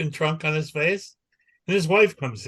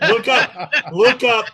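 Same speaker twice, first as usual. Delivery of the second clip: louder and higher, pushing the point; loud urging; 9 semitones higher, 8 dB louder, slower than usual. Real, not acted. Telling listeners to search the clip, loud urging. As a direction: insist loudly. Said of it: "urging listeners".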